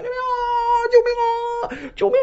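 high desperate wailing cry for help; cries for help. Acted. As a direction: scream for help, sobbing and terrified. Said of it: "就喊救命啊". (哭喊着高声呼救)

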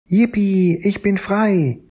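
very high, joyful little-dinosaur cheer; calling out happily. Acted. The freed Triceratops cheers at its rescuers, very high and joyful. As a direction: shout your freedom in a tiny high voice. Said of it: "rief der Triceratops".

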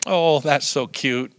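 soft, slow doting grandparent coo. Retold or imitated. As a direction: coo it over the grandchild softly. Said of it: "we're saying".

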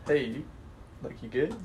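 soft, low-key concerned question; tentative check-in. Retold or imitated. Man to girlfriend after an odd noise, hushed and tentative. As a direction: check on them softly, carefully. Gently checking on partner in story.

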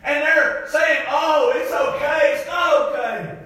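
full-voiced, driving sermon delivery; impassioned preaching. Real, not acted. Preacher urging the church to be ashamed of sin, impassioned preaching. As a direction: preach it hard to the congregation.

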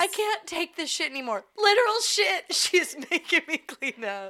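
high, loud mock wail of a wronged worker; mock tearful rant. Teasing her cohost by acting out her tearful demand, high mock wail. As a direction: ham up her crying to the boss.

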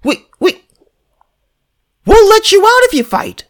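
high-pitched mocking official; high-pitched parody of Ukraine's offer. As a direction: squeak it out like a silly bureaucrat. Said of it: "They said".

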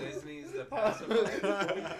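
laughing. Real, not acted. Mocking movie title while laughing.

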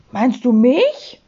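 slow, wounded question with a rising end; hurt. A narrator's reading. Sams to Herr Taschenbier who called it a stupid thing, hurt. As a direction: ask it slowly, taken aback and offended. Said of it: "sagte das Sams gekränkt".

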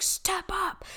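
hushed whispered coaching; breathy urgent whisper; Tony Robbins. Retold or imitated. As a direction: whisper it like a motivational coach leaning in. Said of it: "He's like".

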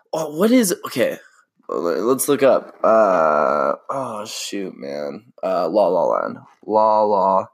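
ordinary talk, nothing marked

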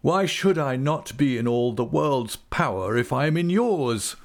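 high, desperate challenge; cornered man crying out. A narrator's reading. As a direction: rise in pitch to a despairing cry. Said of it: "cried Mister Wickfield with a desperate air".

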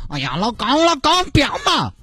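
put-on high, whiny girlfriend voice; coy girlfriend. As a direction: play the spoiled girlfriend acting coy. (撒娇的女朋友，高音嗲声)

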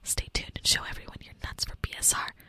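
whispered sponsor read; ASMR ad read. Pretend. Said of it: "live read in A S M R".

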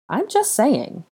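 high-pitched protest; defensive. A narrator's reading. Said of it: "Harry replied".

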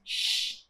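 "X" as a long voiceless "sh" hiss; isolated X sound. Shown as a demonstration. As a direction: sound out "X" as shhh, no vowel. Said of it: "Tem o X?".

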